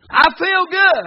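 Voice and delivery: high, loud, excited exclamation; pumped-up reply. Retold or imitated. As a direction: shout it back with full enthusiasm. Excited answer in retold practice.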